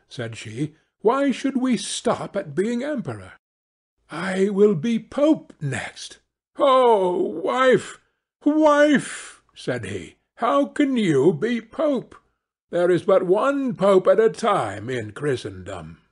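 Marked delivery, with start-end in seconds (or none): 1.0-6.2 high-pitched, imperious wife; ambitious wife. Acted. Wife demanding to be pope, imperious. 6.6-9.4 high, loud cry of dismay; dismayed husband. Acted. Husband aghast at his wife's ambition, dismayed. 10.4-16.0 high-pitched, incredulous husband; protesting husband. Acted. Husband doubting his wife can be pope, protesting.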